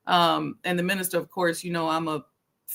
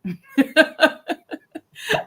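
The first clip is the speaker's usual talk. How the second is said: real laugh; 7 semitones higher, 4 dB louder than usual. Real, not acted. Laughs at own joke.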